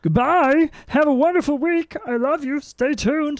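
falsetto farewell; silly high sign-off. Pretend. Host waving off listeners, hammed-up falsetto goodbye. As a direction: bid goodbye in a very high put-on voice.

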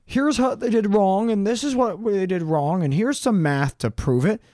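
high, smug know-it-all voice; mock flat earther. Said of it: "And the flat earthers are like".

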